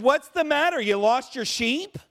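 hammed-up high innkeeper joke; joking innkeeper. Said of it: "And he said".

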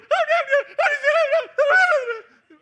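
put-on high, loud child's wail, half laughing; a little girl wailing over her lost doll. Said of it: "Francis goes".

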